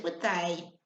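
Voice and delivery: plain reading voice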